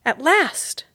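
high, loud relief; triumphant cry. Acted. Mouse finally finding the pill, triumphant. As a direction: burst out with joy. Ralph finds the aspirin.

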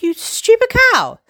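high, loud bitchy jab; catty insult. Retold or imitated. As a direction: sneer it like a diva. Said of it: "And I was like".

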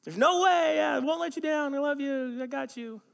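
high, loud gushing promises; hammed-up devoted husband; overblown vows. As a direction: pledge it over the top.

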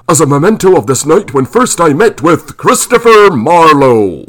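put-on eager fan in a country hayseed accent. A narrator's reading. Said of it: "His hayseed accent was thicker".

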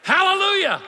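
very high, loud hallelujah cry; exultant shout. Acted. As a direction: shout it out like a revival climax. Climax of acted conversion prayer.

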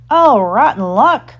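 slow, loud, high lament; exclaiming pirate. A narrator's reading. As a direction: cry out in pirate disappointment. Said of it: "cried Starkey".

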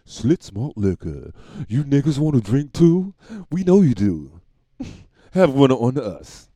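loud, slow mock ad voice; commercial pitchman. Pitchman selling malt liquor, hammed-up commercial voice. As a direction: sell it like an old liquor ad. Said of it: "if it was Schlitz malt liquor commercial".

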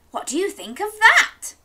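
pleased with his surprise; lightly voiced beaming dad; grinning dad. A narrator's reading. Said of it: "grinned Dad".

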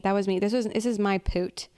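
ordinary delivery

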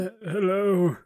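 high, put-on character greeting; timid-sounding high hello; hesitant merchant. Game master voices Digsby.

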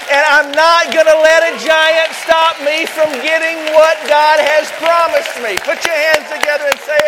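high, loud, rousing preacher build; full-force preaching. Acted. Pastor rousing his congregation, preaching at full force. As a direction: whip the crowd up from the pulpit.

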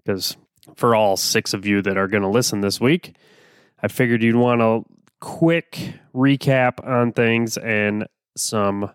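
ordinary delivery

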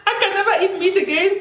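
high, laughing mock horror; laughing quote. Woman quotes friend turned vegetarian, laughing high. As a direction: quote the friend through giggles. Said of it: "She said".

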